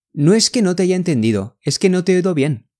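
fast, fluent burst; all at once. Shown as a demonstration. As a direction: rattle it off in one go. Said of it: "这个要一下子".

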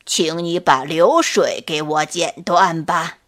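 put-on low, calm old turtle voice. A narrator's reading. Old turtle calmly challenging the scorpion to a bet. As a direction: lay out the bet steadily. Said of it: "指着流水说".

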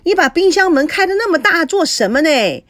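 loud, high nagging call across the kitchen; yelling about the open fridge door; scolding shout. A narrator's reading. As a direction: scold her like a fussy landlady. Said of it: "见状喊着说".

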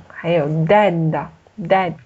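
"dead" and "that" said very slowly and apart; slow word contrast. Shown as a demonstration. 老师慢读"dead"和"that"让学生对比. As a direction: stretch each word so the difference shows. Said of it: "就这两个单词两个意思".

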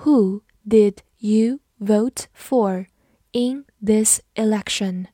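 measured, separated words with clear gaps; slow reading. Shown as a demonstration. Teacher slowly modelling the election question for learners, slow reading. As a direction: read slowly, word by word. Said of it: "好，慢读一遍".

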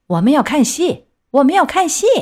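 impatient shouting. A narrator's reading. Audience wants the play to go on, impatient shouting. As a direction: demand it like a fed-up crowd. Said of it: "不耐烦，开始大叫".

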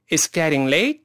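正常语速连贯读，late上扬; 比慢读利落地连读，句尾挑起; 快一点. Shown as a demonstration. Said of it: "快点试试看". (快些示范，一口气读完)

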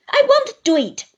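high, loud furious refusal; Uriah swearing. Acted. Uriah refuses Traddles, furious and loud. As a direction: refuse with an oath. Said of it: "with an oath".

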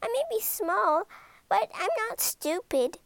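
high, small, proud creature voice; tiny monster boasting to Ben. Acted.

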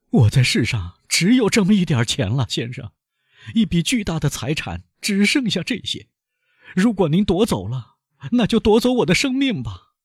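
high, pleading banker's voice; high desperate banker pleading; frightened banker. Acted. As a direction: beg for your last fortune as the desperate banker. Danglars pleads for his money.

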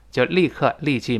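ordinary talk, nothing marked

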